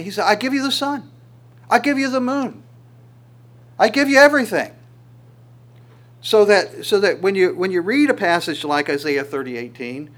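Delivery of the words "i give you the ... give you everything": rising, louder proclamation of love; God's declaration. Acted. Preacher voicing God to his people, rising and louder.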